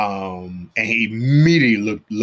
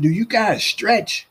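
drawn-out question; 12 semitones higher, 3 dB louder, slower than usual. Retold or imitated. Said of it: "I said".

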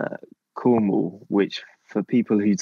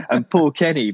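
ordinary talk

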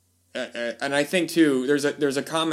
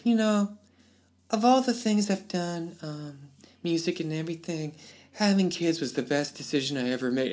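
much higher, hushed teary celebrity imitation; soft confession from a fragile star; 4 semitones higher, 4 dB quieter than usual. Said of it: "you killing me with that voice".

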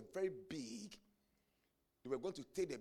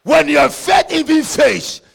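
loud, high, shouted preaching; preaching at full force; 9 semitones higher, 27 dB louder than usual. Acted. Preacher shouting to the congregation about demons, loud and high. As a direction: hammer it home to the church.

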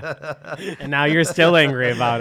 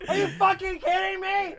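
mock outraged cry, much higher; imitating angry yell. Mocking Tanner's temper, high mock outrage. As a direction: lampoon a hothead losing it. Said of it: "Tanner's like".

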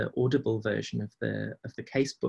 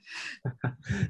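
laughing; 8 semitones higher, 5 dB quieter than usual. Real, not acted. Amused reply to self-deprecation.

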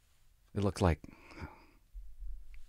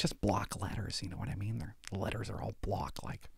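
whisper; 11 dB quieter than usual. Real, not acted. Aside whispered to audience.

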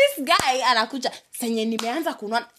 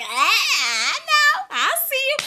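higher, louder delighted squeal; 14 semitones higher, 7 dB louder, slower than usual. Retold or imitated. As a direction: squeal with playful delight at a friend. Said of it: "I'll be like".

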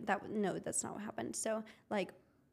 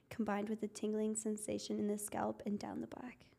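soft unvoiced whisper, reading the definition; hushed, airy read; ASMR whisper; 6 dB quieter than usual. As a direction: breathe the words like an ASMR clip.

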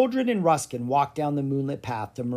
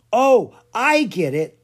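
high, loud, slow dawning; loud slowed-down eureka; realization; 9 semitones higher, 9 dB louder, slower than usual. A narrator's reading. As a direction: let it click out loud. Sudden realization in story.

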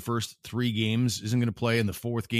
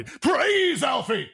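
slow, high, shouted preacher's praise; 5 dB louder, much slower than usual. Acted. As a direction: scream it like a revival preacher. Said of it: "like an old preacher".

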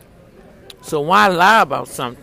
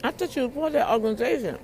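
high, prying question voice; nosy questioner voice; 10 semitones higher, 12 dB quieter than usual. Retold or imitated. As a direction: play the nosy asker. Imitating the questioner again.

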